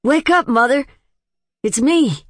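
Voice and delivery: high, loud call to mother; excited shout. A narrator's reading. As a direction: shout up to the house, overjoyed. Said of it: "let out a yell".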